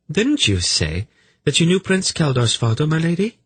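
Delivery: high, polite feminine voice; sly young noblewoman, probing. Acted. Velvet questioning Lady Tamizin, sly and probing. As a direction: probe sweetly in a high, ladylike voice.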